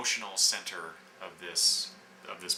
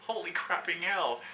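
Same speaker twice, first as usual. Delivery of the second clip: higher-pitched mock exclamation; surprised outburst; 8 semitones higher than usual. She reacts to Thor accepting the invitation, mock astonished. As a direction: blurt it out as a comic reaction.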